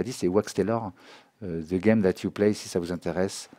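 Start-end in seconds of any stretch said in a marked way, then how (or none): none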